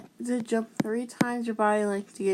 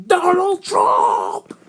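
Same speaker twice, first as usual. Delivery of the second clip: higher, louder, slow proud yell; mock triumphant shout; 9 semitones higher, 8 dB louder, much slower than usual. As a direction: bellow the punchline like a bad Trump. Punchline shouted in a put-on voice.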